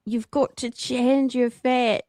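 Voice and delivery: put-on slow, careful Scottish accent; slow Scottish accent attempt. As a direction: drag the words out in a Scottish accent. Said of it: "I can't do it anymore".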